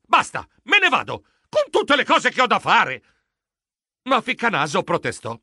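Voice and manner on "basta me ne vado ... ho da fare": high, raised exasperated cry; shrieked protest. A narrator's reading. Geronimo storms off from his pestering friend, exasperated yelling. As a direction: cry out that you've had enough. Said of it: "Io strillai".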